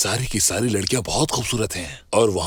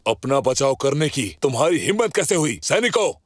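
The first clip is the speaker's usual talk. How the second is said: higher-pitched, outraged command; indignant order; 5 semitones higher than usual. Acted. Official orders soldiers to whip the accused, indignant. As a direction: rebuke him, then pitch up to summon the guards.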